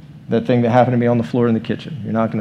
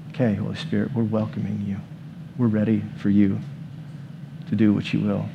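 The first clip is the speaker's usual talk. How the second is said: much softer, hushed invitation; soft prayer; 3 semitones lower, 9 dB quieter than usual. Acted. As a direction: pray gently, inviting. Praying softly, inviting Spirit.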